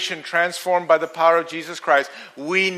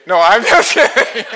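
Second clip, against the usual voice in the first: laughing tease; 3 semitones higher, 6 dB louder than usual. Real, not acted. Teasing congregation.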